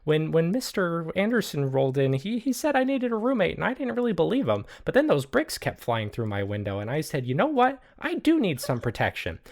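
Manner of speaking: high, put-on character voice, sheepishly explaining; high-pitched, earnest little sidekick; innocent roommate Cheese. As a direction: squeak out a meek excuse. Game master plays Cheese.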